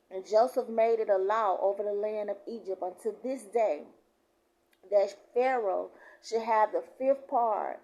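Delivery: loud preacher's proclamation; scripture reading. Real, not acted. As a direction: declare the verse with force. Reads scripture aloud.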